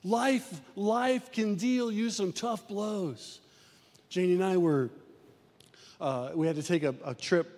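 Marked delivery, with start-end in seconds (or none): 0.0-3.4 impassioned sermon delivery; preaching. Acted. Pastor preaching to his church, impassioned. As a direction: preach it to the congregation.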